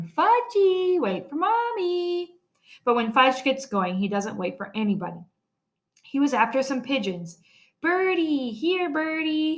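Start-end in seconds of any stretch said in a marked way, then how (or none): 0.0-2.3 high, loud mother's call, slowing on Mommy; lightly voiced mother hollering high. A narrator's reading. 7.8-9.6 put-on high, slow little-kid coo; lightly high toddler call, drawn out; toddler calling birds. A narrator's reading. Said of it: "he calls".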